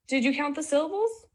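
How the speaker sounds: ordinary talk, nothing marked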